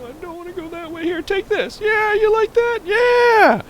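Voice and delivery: very high, loud, gleeful shouting; giddy high cheering; gleeful whooping. Real, not acted. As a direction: whoop like on a thrill ride. Rocket spinning, gleeful.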